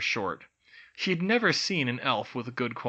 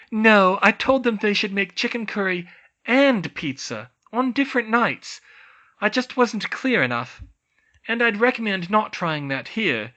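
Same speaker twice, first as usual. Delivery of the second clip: Hermione voice; 9 semitones higher, 8 dB louder than usual. A narrator's reading. Hermione replying.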